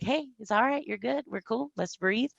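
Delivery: soft, soothing reassurance; hushed, reassuring voice; calming her down. Retold or imitated. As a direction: talk a friend down gently and quietly. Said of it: "I always have to calm her down".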